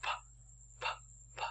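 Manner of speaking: p bật hơi, không rung cổ; bật âm "p" nhẹ, không rung cổ họng. Shown as a demonstration. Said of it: "âm này là âm p". (phát âm "p" bật hơi không rung cổ)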